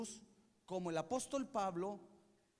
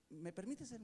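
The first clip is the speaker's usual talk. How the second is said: much softer, meek little question; timid husband; 2 semitones lower, 10 dB quieter than usual. Retold or imitated. Husband asking his wife's leave, meekly. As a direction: shrink away as if asking permission. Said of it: "Y muchos así como que".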